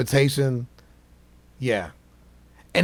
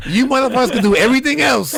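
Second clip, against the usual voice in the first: high loud line through laughter; laughing; 12 semitones higher, 6 dB louder than usual. Real, not acted. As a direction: say it cracking up. Laughing joke.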